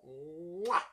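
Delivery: drawn-out, rising "mwah"; big theatrical smacked kiss of admiration; exaggerated kiss noise. Gushing over Jane Seymour.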